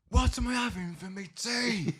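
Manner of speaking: high, slow mock-angry yell; suddenly angry. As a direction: mimic her flaring up, stretching the words. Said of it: "why is she angry now".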